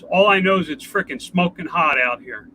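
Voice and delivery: ordinary talk, nothing marked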